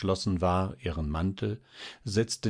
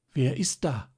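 higher-pitched startled question; 6 semitones higher than usual. A narrator's reading. Marie asks who is knocking at midnight, startled. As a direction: ask in fright, voice lifted. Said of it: "fragte sie erschrocken".

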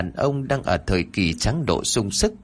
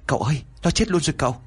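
higher, hurried report; nervous henchman; 6 semitones higher, much faster than usual. Acted. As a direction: rush the words out to the master. Henchman reports woman dead.

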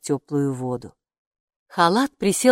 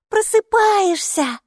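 delighted cry, much higher and louder, drawn out slowly; joyful; 5 dB louder, slower than usual. A narrator's reading. Girl delighted the robe wakes up, joyful slow cry. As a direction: burst with joy that the robe is waking. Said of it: "обрадовалась Майки".

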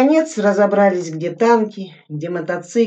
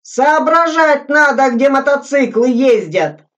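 bellowing; 8 semitones higher, 7 dB louder than usual. A narrator's reading. Soldier bawling at the others, loud angry yell. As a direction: roar it like you were cheated. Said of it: "Заорал".

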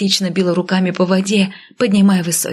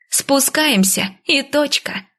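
СПУСКАЕМСЯ drawn out high, then И ТОЧКА; firm finality; 6 semitones higher, slower than usual. A narrator's reading. Heroine ends the argument firmly. (твёрдо, с растянутым высоким «Спускаемся»)

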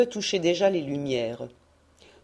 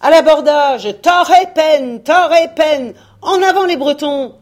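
higher, much louder roaring battle cry; war cry; 9 semitones higher, 11 dB louder than usual. Acted. Leader urging the Bretons to board, roaring war cry. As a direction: charge forward, bellowing.

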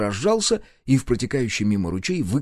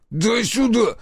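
high, loud bark; 13 semitones higher, 3 dB louder, slower than usual. Acted. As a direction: snap it out at him. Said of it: "рявкнул на меня он".

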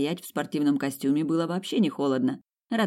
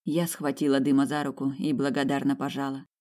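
ordinary delivery

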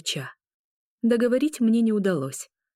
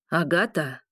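menacing growl; 2 semitones lower, slower than usual. Acted. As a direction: growl her name as a threat. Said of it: "прозвучало пугающим рычанием".

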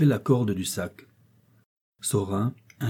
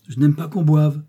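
raised, louder stern rebuke; 6 semitones higher, 7 dB louder than usual. A narrator's reading. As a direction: lay down the rule severely. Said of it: "dit François d'un air sévère".

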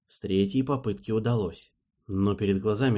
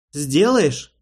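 pleading; 5 semitones higher, 7 dB louder than usual. Acted. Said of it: "спросил демон почти умоляющим тоном".

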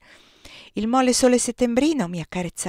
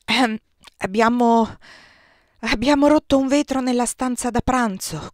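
hesitant, louder false starts before confessing; hurried confession; 4 semitones higher, 7 dB louder than usual. A narrator's reading. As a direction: stumble into the admission, pitched higher. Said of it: "confessò in fretta". (confessione esitante, a voce più alta)

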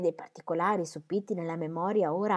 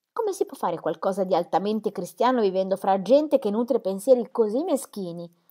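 louder, higher, stung pride; indignant retort; 5 semitones higher, 7 dB louder than usual. A narrator's reading. Dorothea answering Celia's taunt, louder. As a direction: hit back at her sister, raised.

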